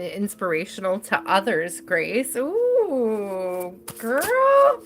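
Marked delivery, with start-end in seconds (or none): 2.4-4.8 teasing excitement. Real, not acted. Reader hyping client over attraction in the cards, teasing excitement.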